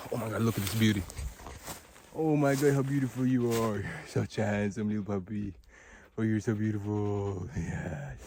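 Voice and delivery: soft cooing praise to a dog. Real, not acted. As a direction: coo gently over the puppy. Talking sweetly to the dog.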